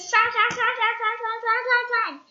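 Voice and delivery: high, loud shrank chant; rising excitement. A narrator's reading. Narrator chanting as Mister Appleseed shrinks.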